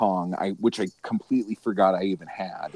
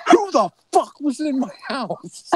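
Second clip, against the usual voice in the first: high-pitched, mock-outraged yelp; mock paranoid outrage; 3 dB louder than usual. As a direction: play up the suspicion that someone broke in. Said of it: "I'm like".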